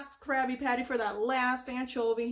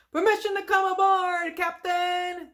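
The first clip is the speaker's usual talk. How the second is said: high, loud heroic announcement; SpongeBob; 6 semitones higher, 6 dB louder than usual. Retold or imitated. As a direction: hail the captain triumphantly. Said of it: "hear SpongeBob just suddenly announcing".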